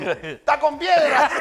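retold shout. Setting up his friend at the gate, retold shout. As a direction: shout it out with laughter behind it. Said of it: "ahí le grito al Tom".

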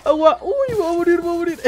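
high shrill mock panic cries; panicked screaming. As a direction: scream like you're on fire. Burning in game, screaming. (gritos de pánico exagerados)

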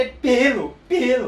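high and loud with delight; thrilled, high and loud praise of the bike; excited exclaim. Retold or imitated.

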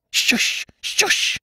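hushed, shocked whisper; scandalized whisper. A narrator's reading. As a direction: hiss it urgently through the keyhole. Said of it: "came a scandalized whisper".

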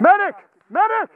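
loud urgent yell for help; man down shout. Real, not acted. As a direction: shout for a medic across the field. Yelling for a medic.

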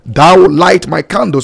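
ordinary talk

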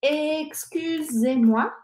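"excusez-moi" drawn out slowly with a rising end; slow model word. Shown as a demonstration. Teacher introduces the polite opener, slowly. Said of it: "siempre vas a empezar con".

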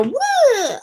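high, held "woo" of things flying; playful spilling sound effect; mock woo. Imitating contents flying out of purse.

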